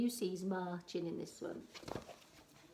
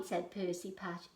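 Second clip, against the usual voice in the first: usual speaking voice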